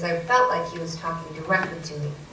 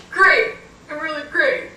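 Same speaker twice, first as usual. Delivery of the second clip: high, loud mock-bright reply; fake cheer; 9 semitones higher, 8 dB louder than usual. Lost sibling answering the happy brother, fake cheer. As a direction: force out cheer you don't feel.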